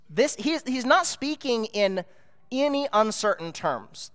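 usual speaking voice

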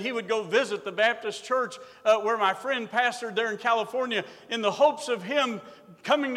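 loud, ringing sermon delivery; full-force preaching. Acted. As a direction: preach it out to the whole church.

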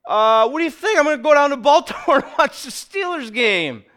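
pleading to wife. Retold or imitated. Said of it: "And then I go".

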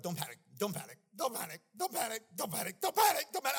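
building from plain to a high loud shout; screaming don't panic. Retold or imitated. Preacher reliving drowning, building to a panicked shout. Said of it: "I'm, like, screaming".

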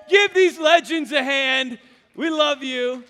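big loud rally to the crowd, slow; booming hype call; loud call to cheer. Real, not acted. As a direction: whip the room into applause. Calling crowd to applaud launch team.

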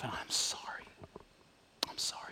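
a broken, quiet confession to God; whispered apology. Pretend. Model prayer of repentance.

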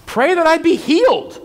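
high-pitched, insistent patient's plea; eager sick woman. Acted. Sick older woman asks young minister to pray, eager high voice. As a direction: voice her hopeful request up high.

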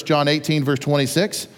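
usual speaking voice

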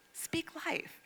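lightly voiced inner prompting; Holy Spirit. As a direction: drop the volume for a gentle instruction. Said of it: "the Holy Spirit spoke to me".

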